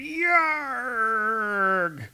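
high hammed-up battle yell; Thor's cartoon war cry; mock war cry. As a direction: shout it like a charging hero. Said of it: "Thor going".

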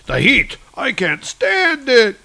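high, slow strained cry; fleeing villain. Acted. Marauder fleeing Thor's lightning, high strained cry. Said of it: "the Marauder's like".

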